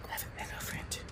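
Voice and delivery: conspiratorial whisper; whispered delight over a little friend. Real, not acted. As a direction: let the secret out in a hush. Secretive about the rat.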